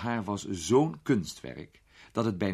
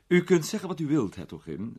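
put-on gallant young lord, raised voice; lightly voiced gallant lord; lightly voiced young lord, louder; 9 semitones higher, 5 dB louder than usual. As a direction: sweep into the gallant greeting. Peter voiced in story.

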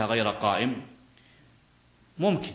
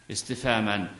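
ordinary talk, nothing marked

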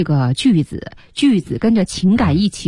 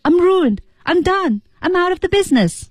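higher, despairing falls on each phrase; desperate feeling; 12 semitones higher than usual. Shown as a demonstration. Teacher shows students the despairing emotion behind the line. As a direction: say it as if everything is lost. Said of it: "它是一个什么情绪下出来的".

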